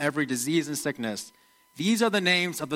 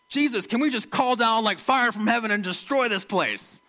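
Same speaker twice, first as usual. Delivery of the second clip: high, heated plea for fire; the sons of thunder itching for revenge; mad brothers; 6 semitones higher than usual. Retold or imitated. James and John ask Jesus to burn the village, angry and high. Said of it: "they get so mad".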